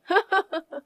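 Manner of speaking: high, light laugh; pleased little laugh; happy laugh. Acted. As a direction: laugh with relief. Relieved, happy about divorce.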